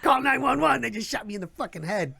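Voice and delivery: brother bursting in to tell dad, mock panicked plea; a retold panic, half-played. Brother begging dad to call for help, mock panic. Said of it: "rolls up in a panic".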